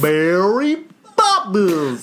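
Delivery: slow, drawn-out mock Cockney; mock Cockney accent. Teasing a friend about the film's accent, slow mock Cockney. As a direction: stretch it out like a bad Cockney. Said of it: "I don't sound like that at all".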